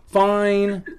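sighed, grudging agreement; reluctant giving in. Real, not acted. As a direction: give in with a drawn-out sigh. Gives in reluctantly after sighing.

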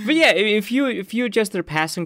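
high voice just out of a real laugh. Real, not acted. Analyst getting back to his point, still laughing. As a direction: carry on talking as the laughter subsides. Resumes after laughing at joke.

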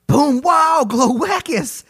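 huge high-pitched yell; all-out hammed-up roar; explosive shout. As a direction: bellow it like a wrecking monster. Acting out monster smashing a church.

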